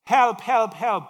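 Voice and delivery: loud frantic cries for help. Acted. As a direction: shout for help. Man dangling from cliff.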